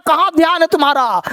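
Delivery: high, fast teacher scold. Real, not acted. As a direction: snap at a daydreaming pupil. Teacher scolds inattentive student.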